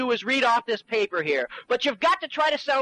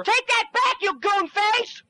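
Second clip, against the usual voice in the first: furious shout; 8 semitones higher, slower than usual. Acted. Little man screaming threats at his tall partner, furious. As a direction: bellow threats with full fury. The fight escalates.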